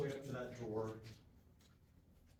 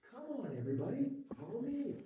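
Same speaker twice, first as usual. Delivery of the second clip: chipper voice; 11 semitones higher, faster than usual. Shown as a demonstration. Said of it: "in the chipperest voice you can think of".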